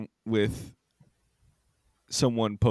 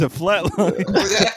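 slow, laughing stoned drawl; stoner; 8 semitones higher, 4 dB louder, much slower than usual. Retold or imitated. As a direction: draw it out slowly through a laugh. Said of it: "You're like".

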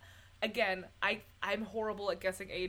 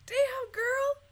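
excited; 14 semitones higher, 6 dB louder, much slower than usual. Real, not acted. Excited reaction to Jean Hagen.

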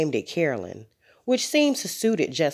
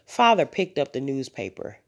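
ordinary delivery

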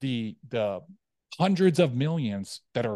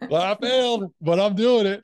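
high and loud, still laughing; laughing admission; 11 semitones higher, 8 dB louder than usual. Real, not acted. Admitting to her guest she failed, laughing it off. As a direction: own the failure with a grin.

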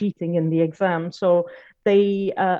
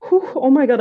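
a high shaky whew, louder, then a steadier admission; emotional exhale; 6 semitones higher, 6 dB louder than usual. Real, not acted. Catching herself tearing up mid-story, emotional exhale. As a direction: blow out the feeling before you name it. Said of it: "I'm getting so emotional".

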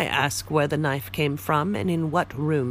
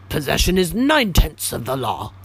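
curt and louder, brushing him off; brusque; 3 semitones higher, 5 dB louder than usual. Acted. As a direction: snap it back brusquely. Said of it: "in a brusque tone".

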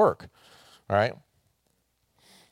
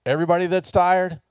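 higher, louder call, hey come here; 9 semitones higher, 5 dB louder than usual. Retold or imitated. Pastor voicing Jesus calling the weary, loud call. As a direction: call the tired over to you. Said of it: "So Jesus is saying".

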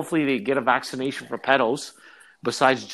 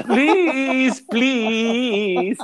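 high, hammed-up pleading; mock begging; 7 semitones higher, 5 dB louder than usual. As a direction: imitate a caught man begging for mercy. Imitating man begging in video.

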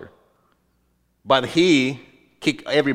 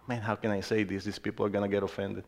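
much softer, quick muttered inner thought; private aside; 7 semitones lower, 14 dB quieter, faster than usual. Retold or imitated. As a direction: drop the voice to a hushed aside to yourself.

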